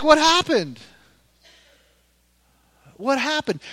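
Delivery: high-pitched, baffled outcry; mock bewilderment. Preacher looking back on years gone by, mock bewildered cry. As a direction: ask it high, as if life vanished.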